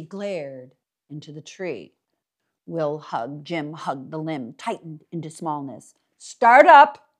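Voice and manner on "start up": loud, high barked order; command. Acted. Mister Dark ordering the carousel started, loud command. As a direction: fire off the command.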